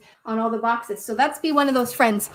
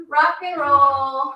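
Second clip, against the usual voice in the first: slow, loud, stretched "Rock and roll"; slow drawn-out cue; 6 dB louder, much slower than usual. Acted. Yoga teacher cueing kids to rock on backs, slow and loud. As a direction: draw out each word as a rocking cue.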